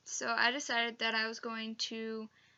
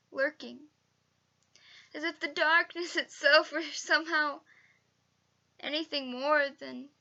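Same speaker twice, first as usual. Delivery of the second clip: halting, higher anxious voice with a sigh; scared, anxious; 4 semitones higher, 4 dB louder than usual. Shown as a demonstration. Student actor performing a monologue on night fears, scared and anxious. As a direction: act nervously afraid, pausing between phrases. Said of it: "scared or anxious".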